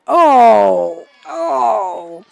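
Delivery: loud, high drawn-out groan; anguished "oh". Real, not acted. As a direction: cry out long and high, sliding down. Reacts to play going wrong.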